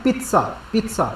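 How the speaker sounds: पिज़्ज़ा quick and high, with a ts sound; Italian pizza. Shown as a demonstration. Teacher repeating the Italian पिज़्ज़ा with a ts sound for students. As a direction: rattle off पिज़्ज़ा twice, the Italian way, with a ts. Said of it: "वहाँ क्या बोलते हैं?".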